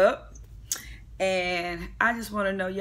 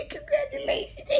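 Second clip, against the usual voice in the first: a high, giggling squeal of congratulations; mimicking Kenya's giggly high thanks. Kenya squealing congratulations back at Nene. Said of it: "Kenya like".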